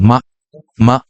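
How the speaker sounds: মা, মা falling sharply; মা, মা with a hard fall; angry fourth tone. Shown as a demonstration. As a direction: snap মা, মা down like angry talk. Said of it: "এই টোনটা হলো রাগান্বিত টোন".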